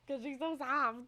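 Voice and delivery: ordinary talk, nothing marked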